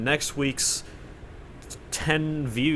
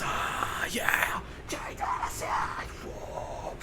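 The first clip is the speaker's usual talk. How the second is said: mock crowd cheer; 7 semitones higher, 8 dB quieter, much slower than usual. Imitating a crowd cheering from afar.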